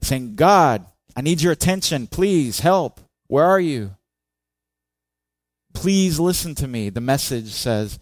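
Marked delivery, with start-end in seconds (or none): 0.4-6.9 high, loud, anguished plea; Asaph yelling. Acted. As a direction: yell for help, begging to be heard. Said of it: "and he's yelling".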